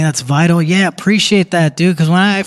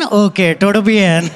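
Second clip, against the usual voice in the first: put-on Indian accent. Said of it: "speaking Spanish in an Indian accent".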